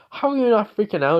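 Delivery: high, loud freaked-out question; freaking out. Retold or imitated. Girl to Naruto after waking beside him, freaking out. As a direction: voice jumps up in retold panic. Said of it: "She kinda freaks out a little bit".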